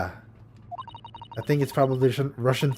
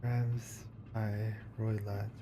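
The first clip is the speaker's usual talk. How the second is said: Grimsby Roylott murmured very slowly and much softer; slow, softer musing; 10 dB quieter, much slower than usual. Shown as a demonstration. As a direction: mumble the name to yourself, stretching Grimsby. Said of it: "I'm trying to find the pun in this name".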